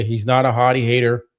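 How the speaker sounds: ordinary talk, nothing marked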